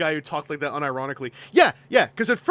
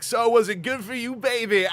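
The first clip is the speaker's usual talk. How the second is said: higher, louder cheesy "good for you" line; smug lover voice; 7 semitones higher, 4 dB louder than usual. Pretend. Streamer's lazy character asks his lover, hammed-up. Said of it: "wakes up going".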